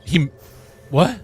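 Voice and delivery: loud disbelieving what; surprised what. Real, not acted. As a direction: react in shock. Disbelief at the play.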